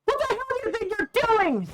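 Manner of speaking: put-on furious tearful girl. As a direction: scream at the cruel fans to defend her idol. Fan grabs idol's hair.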